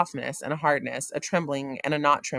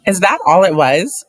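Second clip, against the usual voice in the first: loud, cutting question; sharp retort; 4 semitones higher, 15 dB louder than usual. A narrator's reading. Wife snaps back at husband, loud and sarcastic.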